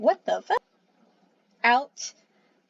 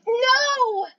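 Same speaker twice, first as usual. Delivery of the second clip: high, loud yell; 13 semitones higher, 3 dB louder than usual. A narrator's reading. Cat yells against the vote, alarmed. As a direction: yell the refusal. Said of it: "Enderhowl yelled".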